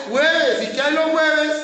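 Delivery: loud, emphatic preacher answer; calling out. Shown as a demonstration. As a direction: hammer the answer out to the room.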